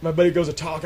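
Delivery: ordinary delivery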